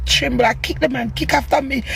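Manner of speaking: ordinary talk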